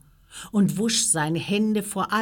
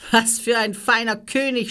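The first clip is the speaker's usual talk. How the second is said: higher, loud jeer; mocking; 6 semitones higher, 7 dB louder than usual. A narrator's reading. Soldiers making sport of the prisoner, mocking. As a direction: sneer it in mock admiration. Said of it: "spotteten sie".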